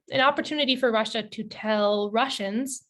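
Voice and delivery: ordinary talk, nothing marked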